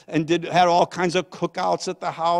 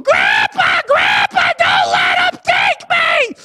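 put-on screaming little girl, very high and loud; Maya; 11 dB louder, slower than usual. Granddaughter dragged through airport security, screaming for grandpa. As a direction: shriek it like a desperate child pleading. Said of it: "little Maya looking back at me going".

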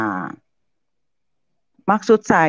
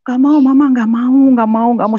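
put-on higher and louder child's wail; louder imitated child refusal; child protesting, tugging; 9 semitones higher, 7 dB louder than usual. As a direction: cry out like a kid pulling Mama back. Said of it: "sambil ditarik-tarik".

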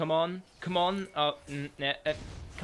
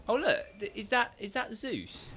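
surprised discovery; 8 semitones higher than usual. Real, not acted. Spots Zeus in surprise.

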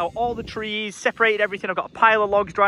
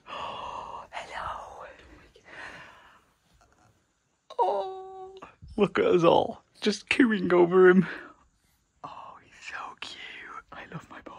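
whispering; 7 dB quieter, slower than usual. Real, not acted. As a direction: whisper so he won't wake. Sleeping son in tent.

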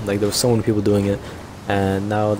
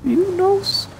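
very high, whistling-S cartoon voice; Herbert the Pervert impression; slower than usual. Gamer imitating a cartoon character's talk, high impression voice. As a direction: put on a high voice with sibilant S. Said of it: "Feel like Herbert the Pervert".

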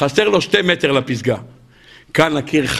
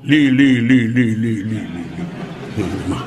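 fading echo; 8 semitones lower, slower than usual. Acted. The mountains echo the climber's cry back, fading. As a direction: let it die away like a mountain echo.

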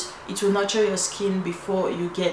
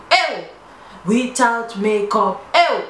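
loud hype calls; excited calling out; 2 semitones higher, 6 dB louder, slower than usual. Real, not acted. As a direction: shout it out with excitement. Hyping up viewers.

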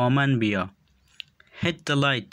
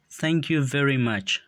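each word said slowly and distinctly for learners; slow and clear; 3 semitones higher, much slower than usual. Shown as a demonstration. Teacher models English phrase for learners, slow and clear. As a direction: model the phrase slowly so students can copy it. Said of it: "به خاطر آموختن تلفظ درستشان".

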